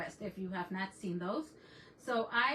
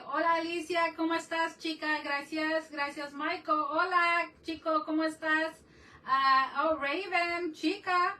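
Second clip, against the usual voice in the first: higher, louder, bubbly calls to each viewer; excited shouty greetings; 5 semitones higher, 6 dB louder than usual. Real, not acted. Welcoming chat friends on her live stream, excited loud hellos. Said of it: "If I sound like I'm shouting".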